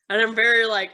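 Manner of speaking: usual speaking voice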